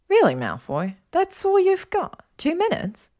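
high-pitched teasing taunt; mocking, incredulous jab. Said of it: "as she taunted him".

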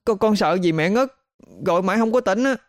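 put-on very high, anxious child voice; frightened little boy. A narrator's reading. Boy telling mother why he fetched neighbour, frightened. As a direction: explain haltingly as a scared child.

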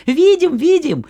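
put-on high, loud goat kids; kids shouting. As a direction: shout back, little goats. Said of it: "да как закричали".

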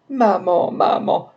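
high-pitched wail of mama; acted sobbing call; sobbing cries. A narrator's reading. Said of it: "elle éclata en sanglots entrecoupés par les cris".

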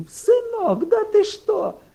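very high, strained cry of disbelief; mother's wail. Retold or imitated. Mother crying out at her stubborn son, very high. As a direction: shriek it like a desperate mother.

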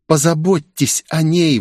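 breathed-out, halting request; exhaled plea. A narrator's reading. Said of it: "выдохнула Оливия".

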